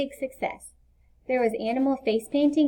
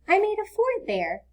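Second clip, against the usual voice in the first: lightly voiced small boy, higher pitch; young boy Leo; 10 semitones higher than usual. A narrator's reading. Leo telling Mom about his lost fort, lightly voiced boy. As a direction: raise the voice into an eager little boy.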